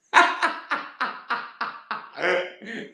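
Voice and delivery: high-pitched burst of laughing; real laughter. Real, not acted. Host reading a listener's silly question, bursting out laughing. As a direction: crack up helplessly.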